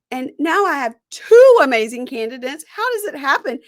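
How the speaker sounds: mock distress. Contestant's dramatic dilemma.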